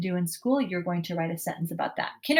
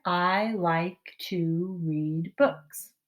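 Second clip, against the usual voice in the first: every word drawn out slowly, one by one; slow careful read; much slower than usual. Shown as a demonstration. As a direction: read it slowly for little ones to follow. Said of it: "Can you make it say that up here?".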